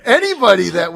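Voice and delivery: laughing as she rushes out her idea. Real, not acted. Host laughing as she explains why she booked the guest, laughing.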